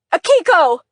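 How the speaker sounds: loud, high shout of her name; forceful call. Acted. Brother calling out his sister's name, loud and forceful. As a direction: call out to stop her falling. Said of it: "a forceful voice called out".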